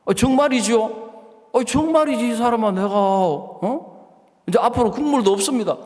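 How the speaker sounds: loud and high, thrown out in protest; mock-furious shout at the husband; heated outburst. Acted heated exchange with husband.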